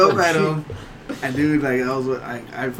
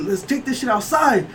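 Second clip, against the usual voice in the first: retold challenge; 10 semitones higher than usual.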